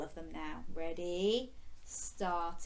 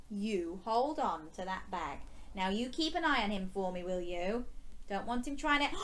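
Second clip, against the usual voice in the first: ordinary delivery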